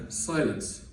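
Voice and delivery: ordinary talk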